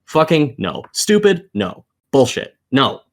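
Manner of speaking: usual speaking voice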